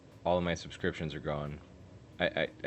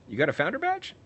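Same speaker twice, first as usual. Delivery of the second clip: excited; 10 semitones higher, 6 dB louder, faster than usual. Real, not acted. Excited at viewer's badge.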